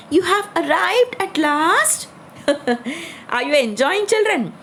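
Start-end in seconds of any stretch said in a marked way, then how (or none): 0.1-2.1 mild high-pitched call. A narrator's reading. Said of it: "but calling". 2.5-3.2 brief soft laugh; soft chuckle. Real, not acted. Storyteller chuckles after hedgehog's line.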